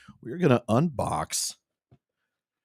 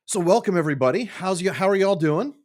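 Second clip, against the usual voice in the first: excited greeting; 9 semitones higher, 5 dB louder than usual. Real, not acted. Bright stream greeting.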